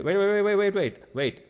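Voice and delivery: high, loud calls of "wait" to stop him; older brother calling after the leaving master. Retold or imitated. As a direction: call out after someone hurrying off. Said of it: "And he said".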